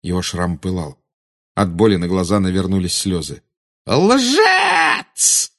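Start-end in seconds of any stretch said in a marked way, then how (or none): 3.9-5.5 very high furious scream. Acted. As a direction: scream the word at him. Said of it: "завопила она".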